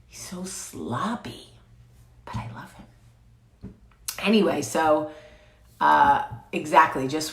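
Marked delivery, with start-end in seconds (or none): 0.1-3.7 soft unvoiced whisper, slow. Real, not acted. Telling viewers about her dog, whispering. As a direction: hush it so the sleeping dog stays calm.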